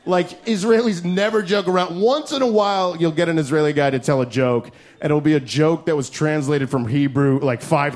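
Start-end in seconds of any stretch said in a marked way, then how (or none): none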